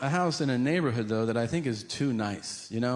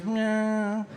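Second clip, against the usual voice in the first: high mock cat meow; playful imitated meow; 9 semitones higher than usual. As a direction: purr out a little meow at him. Senator meows again.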